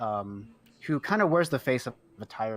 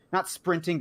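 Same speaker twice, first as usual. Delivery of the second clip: normal talking voice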